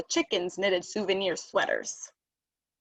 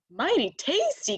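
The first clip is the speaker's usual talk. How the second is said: higher, alarmed exclamation; startled shout; 6 semitones higher than usual. A narrator's reading. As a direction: jump up in shock at the words. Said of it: "Cassie shouted as she jumped up".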